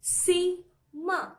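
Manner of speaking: "Sima" split into two slow beats; syllable by syllable. Shown as a demonstration. Teacher shows students how chin drops count syllables, syllable by syllable. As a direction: break "Sima" apart, one syllable at a time. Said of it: "pronounce the word Sima".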